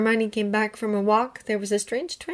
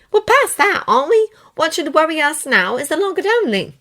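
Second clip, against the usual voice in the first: Hermione voice; 10 semitones higher, 8 dB louder than usual. Acted.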